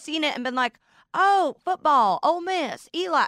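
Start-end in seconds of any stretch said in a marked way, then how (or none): 1.1-3.3 put-on Southern accent. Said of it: "I do that accent lovingly".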